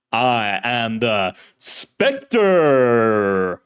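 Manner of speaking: booming, grandiose self-introduction with a long drawn-out final name; old-time radio hero. Acted. Shadowy hero announces himself to the officer, booming radio-serial voice. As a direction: proclaim it like a masked serial hero. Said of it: "voice was like an actor in an old-fashioned serial".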